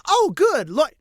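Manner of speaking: high, loud happy burst; light up with relief; relieved delight. Acted. Riho spots who she thinks is Lloyd, high happy cry.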